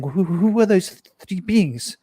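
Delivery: imitating her terrified pleading; frightened crying. Ex-wife in shock after the beings, frightened and pleading. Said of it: "And she's crying".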